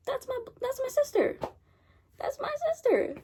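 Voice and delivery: squeaky high mocking voice; sarcastic. Mocking her family's fuss over her sister, high and sarcastic.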